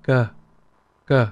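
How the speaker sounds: "K" with almost no puff of air; unaspirated "K", gentle release; soft Vietnamese K. Shown as a demonstration. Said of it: "is a little bit less pronounced".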